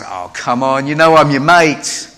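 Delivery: loud, jokey brush-off. Retold or imitated. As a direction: laugh off the question loudly. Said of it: "Peter sort of goes".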